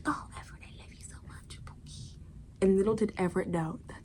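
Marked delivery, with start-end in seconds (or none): 0.1-2.2 breathy lover's whisper, much softer; seductive whisper. Retold or imitated. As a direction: whisper sweet nothings into his ear. Queen seducing Everett in bed.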